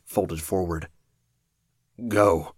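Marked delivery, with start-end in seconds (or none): 2.1-2.5 pained grunt. Acted. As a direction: force it out through pain. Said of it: "the cape grunted".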